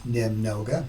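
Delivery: немного said as "nim-NO-ga", unstressed ending; Russian word pronounced. Shown as a demonstration. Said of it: "It sounds like they're saying".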